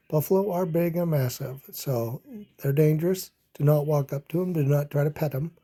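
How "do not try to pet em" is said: plain reading voice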